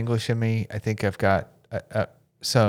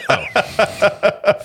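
ordinary delivery

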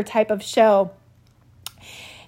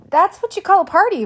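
sarcastic; 9 semitones higher, 5 dB louder, faster than usual. Real, not acted. Ironic about the ER ordeal.

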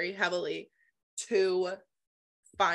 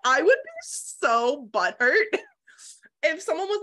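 giggling through the words, pitched up; laughing; 9 semitones higher, 6 dB louder than usual. Real, not acted. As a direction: laugh while saying it. Laughing at own reaction.

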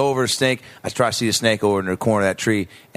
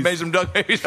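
laughing repeat; 6 semitones higher than usual. Real, not acted. Repeating a friend's joke to the group, laughing. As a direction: echo the joke while laughing.

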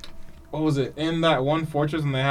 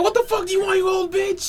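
angry yell. Retold or imitated. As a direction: yell an insult at an old woman. Voicing Yusuke yelling at Genkai.

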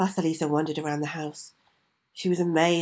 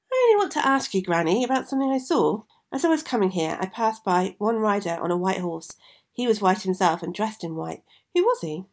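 put-on high, eager young girl; curious young girl; 5 semitones higher, 4 dB louder than usual. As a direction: ask wide-eyed like a child. Vasilisa asks about riders.

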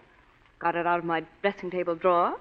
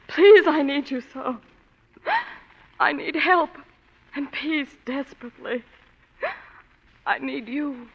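pleading; 5 semitones higher than usual. Acted. Femme fatale pleading with detective.

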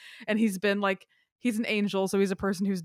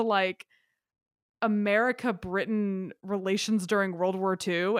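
ordinary talk, nothing marked

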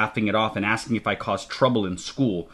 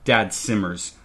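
plain reading voice

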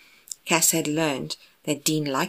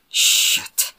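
frustrated moan, more breath than voice; moaned curse; 7 dB louder than usual. A narrator's reading. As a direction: let the curse out as a groan. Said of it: "Dean moaned".

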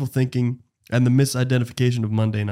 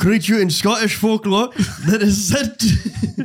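put-on over-the-top Scottish accent, pitched up; 8 semitones higher, slower than usual. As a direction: ham up a Scottish reading voice through a laugh. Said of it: "do it in the accent".

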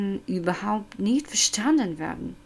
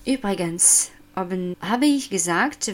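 ordinary talk

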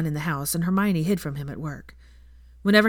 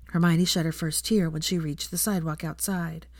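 usual speaking voice

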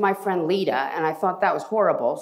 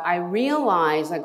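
normal talking voice

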